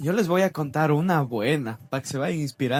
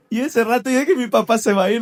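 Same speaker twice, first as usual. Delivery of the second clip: usual speaking voice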